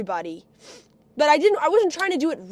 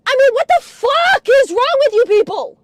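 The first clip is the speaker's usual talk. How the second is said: high, loud yell of disbelief; exasperated outburst; 6 semitones higher, 5 dB louder than usual. Real, not acted. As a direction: burst out shouting in outrage. Outburst after telling grandfather story.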